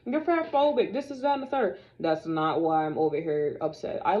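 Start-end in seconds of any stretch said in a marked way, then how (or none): 0.0-1.8 a lightly voiced, higher mocking quote of commenters; mocking critics. Mimicking viewers who call her phobic, mildly mocking. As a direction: toss off their accusations in a sing-song. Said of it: "before y'all go with the whole".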